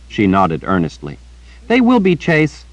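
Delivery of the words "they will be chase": put-on high, earnest little girl chattering; bright-eyed child repeating gossip to the old wizard. A narrator's reading. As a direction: pitch it up like a young girl telling news. Child Rachel voiced.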